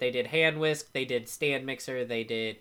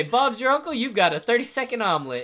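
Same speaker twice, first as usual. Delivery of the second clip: high, loud TV salesman; infomercial pitchman; 8 semitones higher, 6 dB louder than usual. Retold or imitated. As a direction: sell it like a late-night ad. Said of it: "Magic Bullet infomercial".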